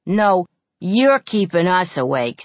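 retort. A narrator's reading. Retort in fight.

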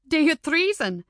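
delighted cry. A narrator's reading. Missus MacDonald delighted by the party's name, a happy cry. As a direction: cry it out with glee. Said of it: "cried Missus MacDonald, delighted".

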